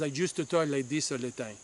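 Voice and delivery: put-on his old thick Puerto Rican accent, shown on purpose. Shown as a demonstration. Vlogger showing viewers the Puerto Rican accent he used to have. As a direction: slip back into the strong Puerto Rican accent he grew up with. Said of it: "which is why I sound like this".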